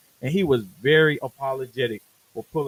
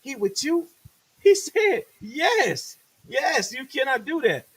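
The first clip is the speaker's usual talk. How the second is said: studio boss; 10 semitones higher than usual. Retold or imitated. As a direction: scold the rapper. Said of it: "Kurt was like".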